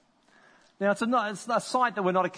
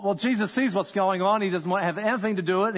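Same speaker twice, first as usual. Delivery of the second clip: ordinary talk, nothing marked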